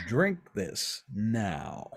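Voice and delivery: very slow, spaced-out order; slow, weighty insistence; deliberate command. Acted. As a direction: order it word by word. Garwood commands him to drink.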